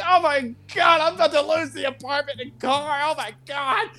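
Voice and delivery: high, frantic freaking out; retold panic. Telling Gio about the apartment, high frantic panic. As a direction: wail about losing everything. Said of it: "I'm like".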